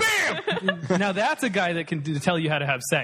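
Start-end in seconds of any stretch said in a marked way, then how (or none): 0.0-0.8 high excited Bam!; Emeril catchphrase. Retold or imitated. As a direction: shout it like a TV chef. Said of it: "Emerald the chef".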